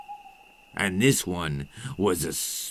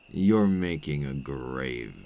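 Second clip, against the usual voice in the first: lower, softer and slow; low threat; 7 semitones lower, 6 dB quieter, slower than usual. Acted. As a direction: let the warning sink in. Vampire's final threat to the listener.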